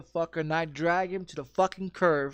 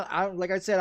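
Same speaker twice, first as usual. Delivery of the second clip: ordinary delivery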